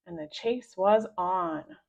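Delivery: usual speaking voice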